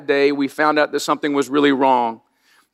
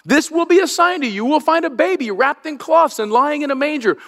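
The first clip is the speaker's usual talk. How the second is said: joyful announcement; 10 semitones higher than usual. Acted. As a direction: proclaim the sign with lifted voice. Quoting the angel's announcement.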